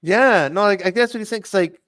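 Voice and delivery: ordinary talk, nothing marked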